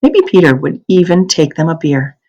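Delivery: ordinary delivery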